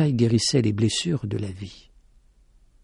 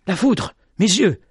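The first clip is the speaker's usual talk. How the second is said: frightened; 7 semitones higher than usual. A narrator's reading. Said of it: "se disait-il".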